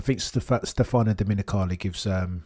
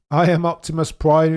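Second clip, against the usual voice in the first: louder, higher mock robot hero; Optimus Prime voice; 7 semitones higher, 8 dB louder than usual. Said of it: "it's the music".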